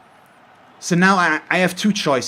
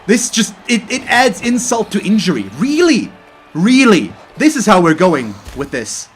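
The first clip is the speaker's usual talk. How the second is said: higher, louder indignant rant; salty rant; 7 semitones higher, 5 dB louder than usual. Real, not acted. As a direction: vent at the undeserved win. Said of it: "I'm sorry for getting this salty".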